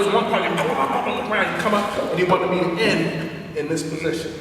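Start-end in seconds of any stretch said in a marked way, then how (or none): none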